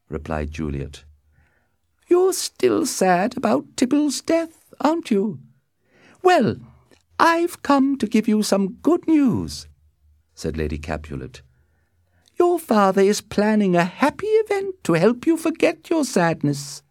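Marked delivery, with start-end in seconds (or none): 2.1-9.7 very high, slow mother voice; high-pitched, deliberate matron; Lady Capulet, brisk mother. A narrator's reading. 12.4-16.8 very high-pitched mother announcing plans; high matron breaking news; Lady Capulet, brisk mother. A narrator's reading.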